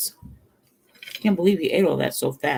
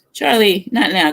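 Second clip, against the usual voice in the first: "Charlie" called out higher and louder; firm scolding; 5 semitones higher, 5 dB louder than usual. Retold or imitated. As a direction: shoo the dog away firmly. Daughter scolding the dog.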